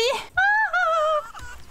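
very high, drawn-out laugh; shrieky put-on laugh; high mock cackle. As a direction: cackle like a cartoon villain. Villainous cackle after the taunt.

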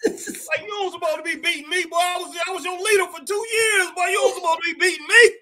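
playful high-voiced complaint to the one who beat him; high mock outrage. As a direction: squeak out the disbelief, half-joking. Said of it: "I'm like".

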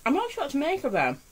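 muffled by hand over mouth; talking while eating cookie; chewing behind hand. Real, not acted. Seen 0: Hand covers mouth while eating; lowered by 5.3, mouth open.

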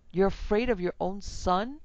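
struggling wife accusing her husband; indignant cry. A narrator's reading. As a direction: throw the accusation at him. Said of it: "she cried, struggling".

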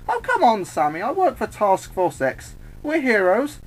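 high, squeaky wheedle; pleading, pitched far up; protesting. Acted. Harley protests to Samus.

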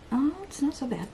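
ordinary delivery

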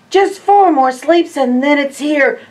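brimming with excitement; excited. Acted. Refrain, excited countdown.

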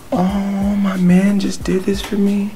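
put-on high, drawn-out girlfriend gush; mock-swooning imitation of a delighted girlfriend; gushing Royalty impression. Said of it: "So, I'm royalty".

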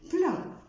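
"بلان" said high with a nasal vowel. Shown as a demonstration. Teacher modelling the word plan. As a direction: end it in the nose. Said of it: "إكزامبل تاني زي بلان".

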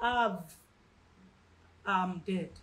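low, quiet, halting give-up; acted exhausted surrender; utterly spent. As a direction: let it die away, low and soft. Acts out believer giving up.

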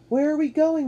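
high, loud shouted question; calling over noise. A narrator's reading. Matt inside the spinning boat, calling over the roar. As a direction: project over rushing air. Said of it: "Matt called above the loud rush".

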